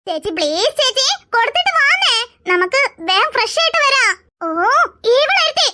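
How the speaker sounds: ordinary delivery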